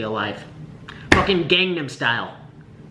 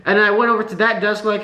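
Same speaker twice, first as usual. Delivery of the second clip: ordinary delivery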